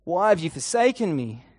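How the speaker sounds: a plea that swells into a loud cry; anguished cry out. A narrator's reading. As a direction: call out to God, rising in force. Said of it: "you hear Jesus cry out".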